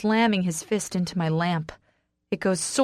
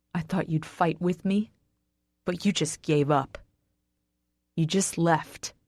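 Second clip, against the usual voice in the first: subdued, mildly tearful reproach; starting to cry. A narrator's reading. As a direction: let the hurt weigh it down. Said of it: "I start to cry".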